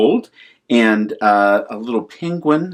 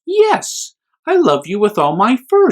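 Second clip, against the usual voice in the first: mother bear; 10 semitones higher than usual. Acted. Mother bear answers tenderly.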